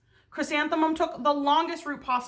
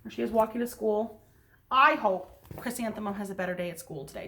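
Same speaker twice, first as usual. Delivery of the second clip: ordinary delivery, nothing marked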